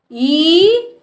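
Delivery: ई drawn out long; long vowel. Shown as a demonstration. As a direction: hold ई steadily. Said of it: "इसके उच्चारण में ज़्यादा समय लगता है". (लंबी खींची हुई ई)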